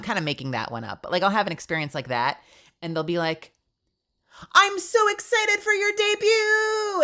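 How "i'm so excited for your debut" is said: high, loud, drawn-out squeal; exaggerated gushing, stretched out; mock gushing. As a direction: squeal it like an over-excited fan. Mimicking gushing supportive peers.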